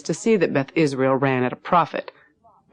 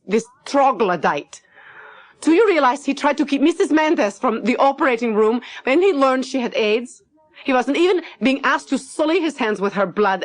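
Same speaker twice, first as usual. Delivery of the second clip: much higher, outraged protest; indignant Lottie; 9 semitones higher than usual. Acted. Lottie protesting to Max about Caldwell, much higher and indignant. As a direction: pour out your outrage at his cruelty.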